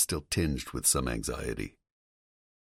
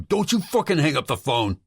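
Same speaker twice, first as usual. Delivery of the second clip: loud, high barked order; barking; 11 semitones higher, 5 dB louder than usual. Acted. Tom stopping Vincent from hanging up, barked loud and high. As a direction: bark it at him so he can't hang up. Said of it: "he barks".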